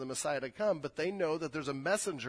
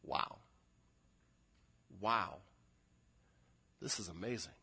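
hushed awe; 6 semitones lower, 5 dB quieter than usual. Acted. Imagining Zechariah's awed reaction.

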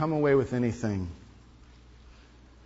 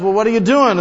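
higher, louder pushy businessman; 9 semitones higher, 10 dB louder, faster than usual. Retold or imitated. Businessman confronting the lazy fisherman, pushy and loud. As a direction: challenge the idler. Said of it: "he goes up and says".

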